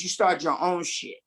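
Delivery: ordinary talk, nothing marked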